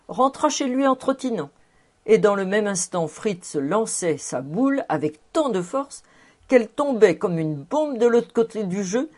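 ordinary delivery, nothing marked

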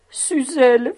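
loud, high-pitched tearful cry; acted sobbing. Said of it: "fit-il en sanglotant".

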